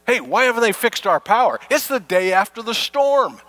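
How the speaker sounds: high, whiny, exasperated griping; impatient neighbor. Retold or imitated. Neighbor griping to the preacher that the power isn't fixed, high and exasperated. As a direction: complain like a neighbor who can't wait another day.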